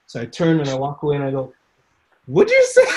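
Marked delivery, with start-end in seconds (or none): 2.3-2.9 a very high, loud what; shocked double take. Retold or imitated. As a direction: whip round in disbelief. Double-take at the stitcher's words.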